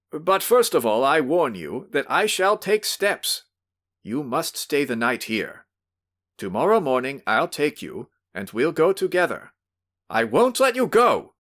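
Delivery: high, loud threatening yell. Acted. Host yells at drunk visitor he'll drag him along. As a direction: bark out the threat. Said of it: "he yelled again".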